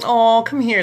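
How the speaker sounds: high, loud imitated coo; star's sympathetic invitation, voice lifted; Jennifer consoling a fan. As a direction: coo it high, as if offering a hug. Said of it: "she's like".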